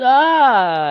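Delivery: high, loud mock scream; badly faked high-pitched shriek; attempted female scream. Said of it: "I can't do a woman's voice".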